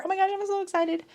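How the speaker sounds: squeaky excited gush; real excitement. Real, not acted. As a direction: gush like you can't contain it. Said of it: "I need to calm down".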